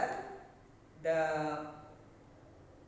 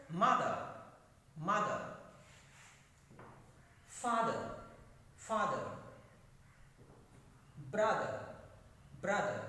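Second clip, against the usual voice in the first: MOTHER, FATHER, BROTHER with a hard first syllable; first-syllable stress. Shown as a demonstration. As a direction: drill each of Mother, Father, Brother, punching the opening syllable. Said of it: "So extra force is used".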